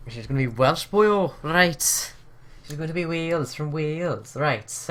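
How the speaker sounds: a really bad Welsh accent; hammed-up Welsh lilt. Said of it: "I'm gonna do a really bad Welsh accent".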